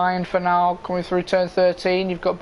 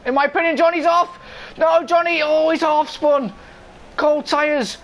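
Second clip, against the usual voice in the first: motorsport commentator; 9 semitones higher, 3 dB louder than usual. Real, not acted. Live race play-by-play.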